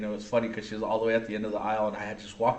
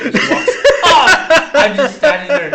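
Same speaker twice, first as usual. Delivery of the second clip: laughing; 14 dB louder, slower than usual. Real, not acted. Laughing at daughter's fart story.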